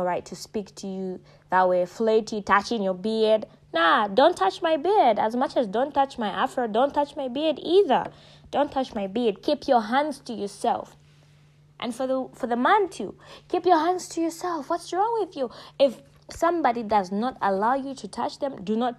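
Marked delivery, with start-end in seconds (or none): 3.7-5.2 firm refusal. Pretend. Telling a woman off. 13.5-15.5 exasperated scolding. Real, not acted. Scolding the man.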